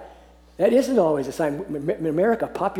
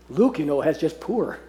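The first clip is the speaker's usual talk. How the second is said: normal talking voice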